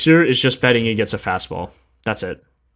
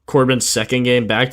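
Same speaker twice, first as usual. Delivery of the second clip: normal talking voice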